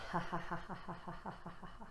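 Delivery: exhaled giggle dying down. Shown as a demonstration. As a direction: let the giggle trail away on the out-breath. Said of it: "And exhale".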